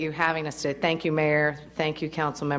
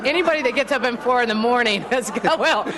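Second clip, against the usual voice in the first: laughing through the words, pitched up; 8 semitones higher, 5 dB louder than usual. Real, not acted. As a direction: chuckle the words out, amused by the joke. Joking about 4 a.m. junkies.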